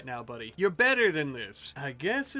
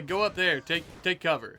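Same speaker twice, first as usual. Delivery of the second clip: ordinary talk, nothing marked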